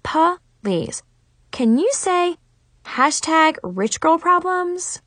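high, drawn-out mocking tone; mock her with exaggerated disdain; eye-rolling sarcasm. A narrator's reading. Girl mocking a rich rival's problems, sarcastic and high.

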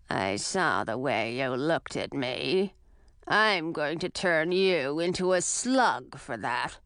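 low, slow, rough crone voice; gravelly old witch. Acted. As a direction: rasp it out like an old hag. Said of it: "In a rough and gravelly voice".

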